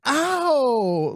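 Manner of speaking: high-pitched emphatic yelp of pain. Retold or imitated. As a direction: squeal it out like an overdramatic guy. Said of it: "turns around really emphatically and goes".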